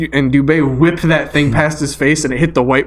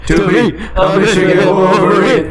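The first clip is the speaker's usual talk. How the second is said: fan cheer shout; 4 semitones higher than usual. Real, not acted. Cheering for team win.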